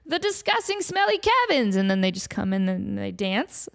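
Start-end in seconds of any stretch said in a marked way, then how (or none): 0.0-1.8 high, grand call to bring them on; show host announcement. Pretend. Host calling in the dancing lobsters, high grand announcement. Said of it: "Be like".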